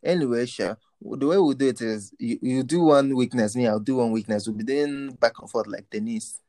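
ordinary talk

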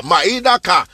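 ordinary talk

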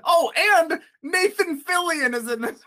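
high, loud, drawn-out gushing; excited. Real, not acted. Movie fan adding a favorite actor to the cast, excited. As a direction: burst out with a thrilling extra detail.

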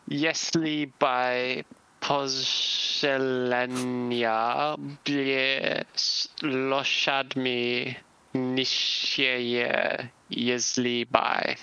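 very slow, sounding out each Russian word; halting Russian reading. Acted. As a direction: stumble through the Russian slowly. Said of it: "hear it in Russian as well".

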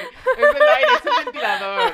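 high, loud spooked squeal; panicked. Real, not acted. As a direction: shriek it through nervous laughter.

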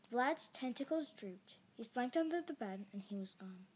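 usual speaking voice

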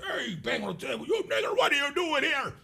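a mock aggressive challenge from a stranger at the pub. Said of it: "Let's say a racist man wanna approach me".

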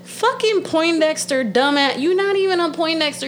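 heated, high-pitched name-calling; worked-up scornful rant; angry insult. Real, not acted. Ranting insults at absent politician.

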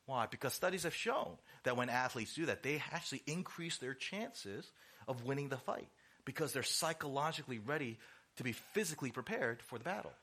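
normal talking voice

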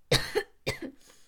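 loud real cough; a sudden cough; coughing fit. Real, not acted. Coughing again.

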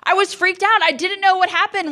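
high-pitched, worked-up; high excited telling. Real, not acted. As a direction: blurt it out, flustered. Animated recollection.

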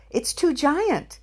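high, slow mock alarm; exaggerated emphasis. Real, not acted. Emphatic joke.

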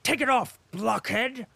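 loud, high impatient snap; angry order. A narrator's reading. Denisov angrily orders his orderly to pull off his boots. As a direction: bark it at the servant. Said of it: "shouted loudly and angrily".

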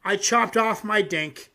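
usual speaking voice

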